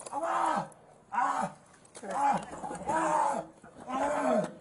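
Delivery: sharp cries of pain. Real, not acted. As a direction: cry out in pain, again and again. Man pinned down crying out.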